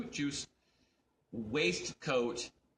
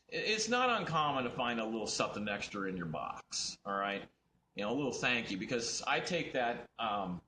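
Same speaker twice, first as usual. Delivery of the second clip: ordinary delivery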